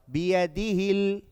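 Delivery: slow chant, final L held; measured tajweed drill for students to echo; Quran recitation. Shown as a demonstration. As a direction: recite it evenly with sustained vowels. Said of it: "tongue basically goes against your teeth".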